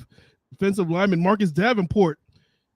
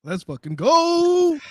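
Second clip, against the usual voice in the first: slow drawn-out hype call, pitched up; 10 semitones higher, 3 dB louder, much slower than usual. Acted. Co-host kicks off game day with a slow, high hype call. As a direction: stretch it out like a pump-up chant.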